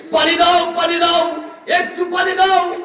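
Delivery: high, pleading shout in a preacher's voice; crying out. Acted. Preacher acting people begging for water on judgment day, high cry. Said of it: "বলে চিৎকার করবে".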